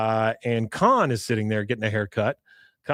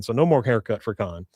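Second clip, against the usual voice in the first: normal talking voice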